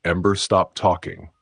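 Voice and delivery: usual speaking voice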